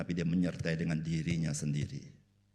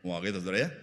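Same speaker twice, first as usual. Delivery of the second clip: normal talking voice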